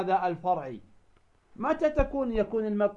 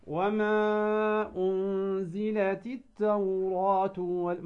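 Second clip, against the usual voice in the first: slow chanted recitation; recited with two-beat madd; much slower than usual. Shown as a demonstration. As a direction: recite slowly, holding the madd two counts. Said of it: "فنقول".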